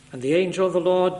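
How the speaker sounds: ordinary delivery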